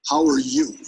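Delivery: commanding, punched downward tune on "How are you"; downswing. Shown as a demonstration. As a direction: lead the room with a firm, dropping "How are you". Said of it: "practice a downswing".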